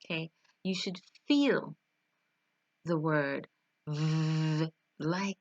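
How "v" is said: a long buzzed "v", held like a motor; engine-like V. Shown as a demonstration. Teacher likening the sound to a car engine, sustained buzzing v. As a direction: hold "v" as a steady vibrating hum. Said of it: "an engine".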